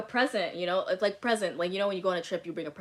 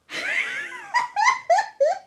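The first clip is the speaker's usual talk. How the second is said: loud, much higher burst of laughter; big high laugh; 9 dB louder than usual. Real, not acted. Laughing at having sex right after the breakup. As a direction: crack up at the absurdity. Laughs at her own story.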